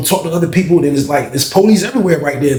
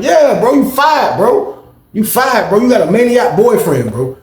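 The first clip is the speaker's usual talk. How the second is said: heated mock rant; 9 semitones higher, slower than usual. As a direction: blast him for the boyfriend drama. Mocking the fired guy heatedly.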